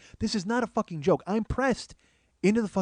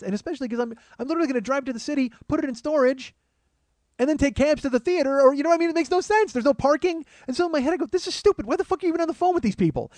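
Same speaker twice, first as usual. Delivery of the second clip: higher and louder, racing ahead; rising exasperated rant; 10 semitones higher, 3 dB louder, faster than usual. Real, not acted. Comic ranting about the pointless car rental, pitch climbing. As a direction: work yourself up into a frenzy.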